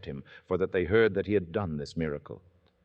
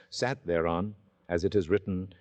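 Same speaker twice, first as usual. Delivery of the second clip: usual speaking voice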